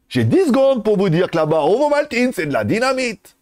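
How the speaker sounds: mock Swiss accent, rising into a silly high voice. Shown as a demonstration. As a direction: put on a bad, comic Swiss accent. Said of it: "l'accent suisse".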